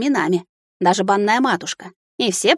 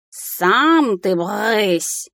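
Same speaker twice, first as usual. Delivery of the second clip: протяжный возмущённый кошачий вопль; очень медленно растяни слова, как орущий кот; вопящий кот; 2 semitones higher, much slower than usual. A narrator's reading. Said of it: "завопил Филя". (завопить котом, растягивая слова)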